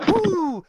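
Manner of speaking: high, loud "woo" of triumph; excited whoop. Real, not acted. Player hears the attack is a hit, whooping. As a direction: let out a high cheer. Cheers after attack lands.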